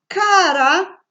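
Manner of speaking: "cara" called out loud and high, hard k first; teacher's loud, clear model of "cara"; sound demonstration. Shown as a demonstration. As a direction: punch the opening k sound of "cara". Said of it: "Tiene el mismo sonido".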